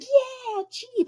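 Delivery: squealing high cousin cheer; super excited. Retold or imitated. Cousin hopping into the Jeep, squealing high with glee. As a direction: shriek it like a giddy friend hopping in. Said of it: "super excited".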